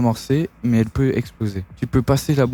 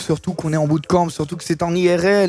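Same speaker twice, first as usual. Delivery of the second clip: streamer hype intro; 5 semitones higher than usual. Acted.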